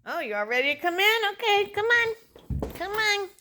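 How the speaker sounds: high, loud talking to a dog. Real, not acted. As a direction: lift your voice up for the dogs.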